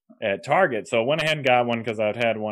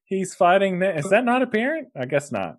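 higher, mock-offended squeak; mock indignant reply; 9 semitones higher than usual. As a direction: play it as exaggerated disbelief. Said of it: "I was like".

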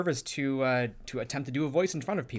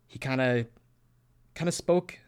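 hesitant, halting delivery with long pauses; lightly voiced unsure speaker, groping for words; reserved, unsure character. Shown as a demonstration. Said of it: "kinda spoke like, like this".

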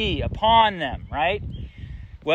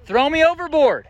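raised, high-pitched acted cry; calling out; 8 semitones higher, 5 dB louder than usual. Jonah telling the sailors it is his fault, calling out. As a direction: call it out high and loud to a crew.

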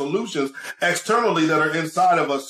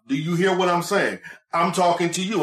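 usual speaking voice

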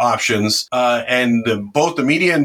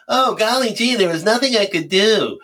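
high-pitched, put-on golly-gee voice; mock helplessness; 6 semitones higher than usual. Fighter mocking people who sit around, in mock-helpless voice. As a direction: mimic a helpless quitter in a higher voice. Mocks those who give up.

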